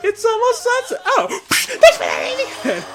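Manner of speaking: very high, loud cartoon cry; Sonic. Imitating the hero at almost sunset, high loud squeal. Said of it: "He's like".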